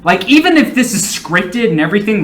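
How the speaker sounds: ordinary delivery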